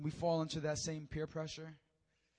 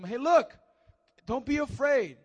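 calling reassurance; 8 semitones higher, 12 dB louder than usual. Acted. Jesus calms frightened disciples.

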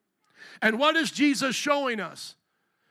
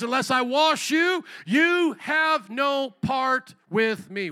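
loud, high, drawn-out proclamation; Jesus; 5 semitones higher, 6 dB louder, much slower than usual. Acted. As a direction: declare it slowly and forcefully, word by word. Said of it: "That's what Jesus says".

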